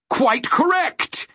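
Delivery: very high, slow villain cry; drawn-out, gloating screech; triumphant shriek. A narrator's reading. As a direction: crow it in triumph. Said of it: "he shrieked triumphantly".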